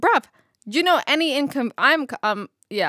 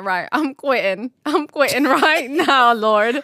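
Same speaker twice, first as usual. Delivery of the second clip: loud, laughing fed-up outburst; hammed-up frustration at a bad workday; mock quitting; 5 dB louder than usual. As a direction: huff that you quit, half laughing. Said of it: "I'm like".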